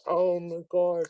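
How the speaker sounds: slow, drawn-out oh my God; imitated slow disbelief; retold oh my God. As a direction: act out a stunned reaction, slowly. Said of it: "like that".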